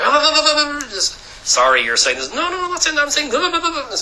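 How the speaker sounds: high-pitched mock rushed caller; rushed phone call. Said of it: "I said".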